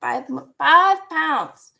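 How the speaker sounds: ordinary talk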